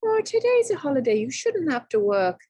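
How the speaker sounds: high, fast, sugary coaxing; syrupy wheedling tone to trick him; very sweetly. Acted. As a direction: sweet-talk him to drop his work. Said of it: "smiled very sweetly and said".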